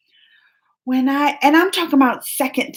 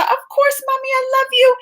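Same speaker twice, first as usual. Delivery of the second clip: put-on high, sweet little girl voice, much higher; sweet little daughter; 14 semitones higher than usual. Mom imitating her sweet daughter saying she loves her, in a high little voice. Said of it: "she's so sweet".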